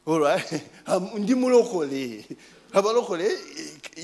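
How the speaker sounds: normal talking voice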